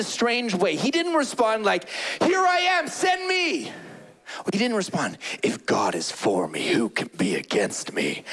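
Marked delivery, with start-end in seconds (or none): 2.2-3.7 eager volunteer. Retold or imitated. Eager volunteer quote, high shout. 5.4-8.2 much lower, puffed-up hero voice; lightly hammed tough guy; mock macho hero. Mock heroic quote, far lower.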